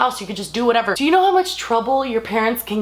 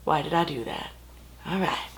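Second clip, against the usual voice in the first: muttered aside; 4 semitones lower, 9 dB quieter than usual. Real, not acted. Aside after signing off.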